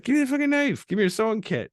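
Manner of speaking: high-pitched, loud acted demand; mock urgent demand. Friend demanding a knife to fix an ear, mock urgent. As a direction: bark it out, high and insistent.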